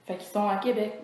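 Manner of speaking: usual speaking voice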